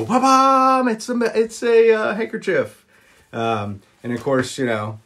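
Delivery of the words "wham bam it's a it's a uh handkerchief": high, loud showman's reveal; dad's magic reveal. Retold or imitated. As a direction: burst out like a stage magician. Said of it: "and then he would go".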